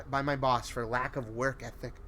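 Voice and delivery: ordinary delivery, nothing marked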